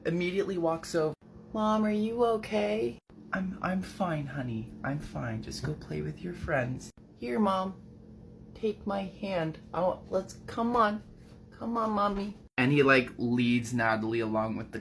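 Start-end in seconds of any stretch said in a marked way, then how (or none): 1.5-3.0 put-on anxious little boy. Voices anxious young son Hugo. 3.3-6.9 put-on soft, weak reassurance to a child; reassuring sick mother. Voices sick mother reassuring son. 7.2-12.4 put-on high, soft small-child voice; caring little boy. Voices young son leading his mother.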